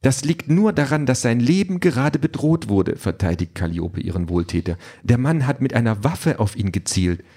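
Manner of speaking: put-on high, earnest young voice; earnest girl defending her benefactor; eager defender. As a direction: stand up for him eagerly. Calliope defends Peter.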